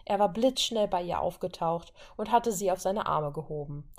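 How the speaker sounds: ordinary delivery, nothing marked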